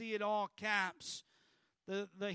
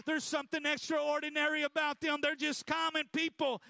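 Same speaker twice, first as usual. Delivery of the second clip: higher-pitched, driving sermon delivery; full-force preaching; 4 semitones higher than usual. Acted. Preacher to congregation on ordinary people changed by God, full-force preaching. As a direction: preach it with urgent, rising intensity.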